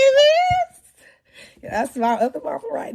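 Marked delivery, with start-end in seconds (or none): 0.1-0.8 calling out. Real, not acted. Calling out to audience member.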